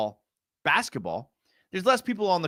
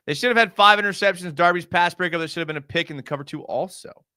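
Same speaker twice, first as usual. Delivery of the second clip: ordinary delivery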